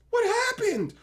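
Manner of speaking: high, baffled squeak of surprise; a high squeal of disbelief; mock baffled. Viewer reacting to the gremlins' sudden slaughter, high and baffled. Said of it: "And you're just like".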